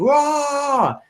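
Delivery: long, high "rah" yell; high war cry without full volume; imitated scream. Coach showing how the seminar crowd screamed, high drawn-out yell. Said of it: "Tony gets us screaming".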